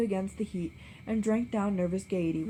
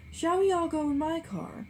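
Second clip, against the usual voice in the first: much higher, louder offer; Gatsby offers his car; eager suggestion; 10 semitones higher, 3 dB louder than usual. A narrator's reading. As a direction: offer brightly. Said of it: "suggested Gatsby".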